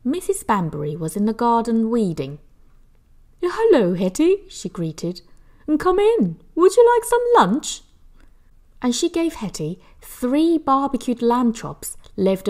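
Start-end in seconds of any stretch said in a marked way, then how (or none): 3.4-4.5 put-on high, bright greeting from an old lady; kindly neighbour. Mrs Banbury greeting Hetty in a high neighbour voice. 5.8-7.8 much higher, louder neighbour voice; kindly neighbour. Acted. Mrs Banbury offering Hetty lunch, high and loud.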